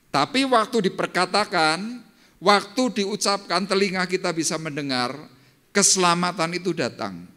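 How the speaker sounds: fiery sermon climax, stretched words; preaching at full force. Acted. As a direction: drive the point home to the congregation.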